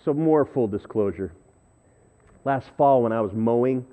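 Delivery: usual speaking voice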